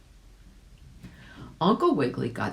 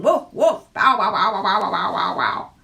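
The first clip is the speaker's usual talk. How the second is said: loud, high woof-woofs fading down; dog barking; 5 semitones higher, 8 dB louder than usual. A narrator's reading. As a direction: bark like a yapping dog. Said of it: "barked the second dog".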